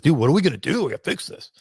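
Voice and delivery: lightly voiced movie boxer impression; Rocky. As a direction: slip casually into a boxer's voice. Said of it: "doing my Rocky voice right now".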